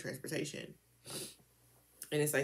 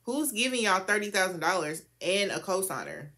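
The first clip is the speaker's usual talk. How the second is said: indignant disbelief; 6 semitones higher, 6 dB louder than usual. Real, not acted. Outraged at unfair loan terms.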